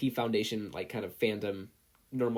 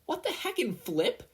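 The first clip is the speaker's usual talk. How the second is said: put-on shrill child shriek, pitched up; high panicked scream; 13 semitones higher than usual. Katsuki screams as the grub slips away, high panic. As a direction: yelp it in disbelief. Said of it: "Katsuki screamed".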